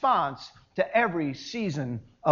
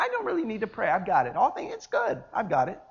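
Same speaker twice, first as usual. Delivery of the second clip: airy, overconfident brush-off; breezy self-assurance; 4 dB quieter, faster than usual. Acted. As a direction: wave the offer away lightly. Mimics someone declining to pray.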